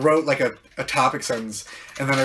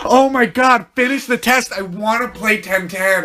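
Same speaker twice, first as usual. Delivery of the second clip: impatient whine; 6 semitones higher, 5 dB louder than usual. Retold or imitated. Impatient kid during test.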